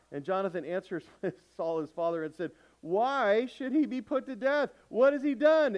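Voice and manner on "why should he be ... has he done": loud, high, slow cry of protest; indignant protest. Acted. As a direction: plead against his father's rage, shouting. Jonathan protests to his father.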